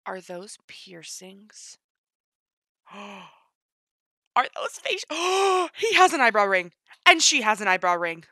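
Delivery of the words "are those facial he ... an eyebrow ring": loud, gasping excitement; freaking out. Real, not acted. Spotting eyebrow rings on new sims, freaking out. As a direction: blurt it out through a gasp. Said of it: "I'm freaking out".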